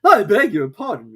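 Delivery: high, loud apologetic voice; a nervous hatter excusing himself; Hatter's voice. A narrator's reading. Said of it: "he began".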